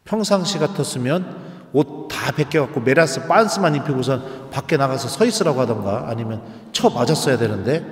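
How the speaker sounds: usual speaking voice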